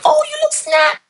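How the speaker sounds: sudden high, airy put-on gush; mock shallow compliment. Coach mocking looks-only trainers, high put-on gushing voice. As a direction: toss it off like a shallow trainer fawning.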